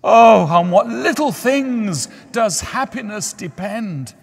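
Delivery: high, loud, slow outburst; a young lover lamenting; anguished student. A narrator's reading. Student voiced in story.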